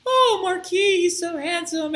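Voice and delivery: very high, gushing swoon; swooning crowd. Retold or imitated. Crowd of women swooning over the handsome Marquis, high gushing.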